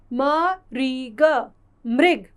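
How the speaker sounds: "म", "री", "ग" slowly apart, then "मृग" whole; spelling out. Shown as a demonstration. Teacher showing students a word with री की मात्रा, spelling out. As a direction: sound out each part of "मृग" and blend.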